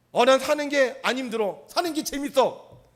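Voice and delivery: breezy carefree voice; cheerful. Retold or imitated. Preacher voicing a happy person who enjoys life. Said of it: "안 힘든 사람은요".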